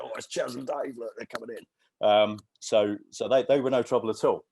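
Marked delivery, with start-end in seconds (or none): 0.0-2.1 lightly voiced onlookers, a bit higher and hushed; mock excited whisper among the crowd; excited gossip. Gate guards spotting the band arrive, a hushed mock excitement. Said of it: "everybody's going".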